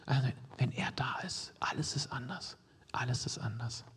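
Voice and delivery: very soft, near-whispered assurance; hushed. Real, not acted. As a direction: sink into a whisper to let it land. Hushed reassurance to congregation.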